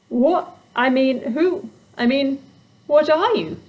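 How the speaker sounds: high flustered stammer. Acted. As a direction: stumble over the words. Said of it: "stammered Susie".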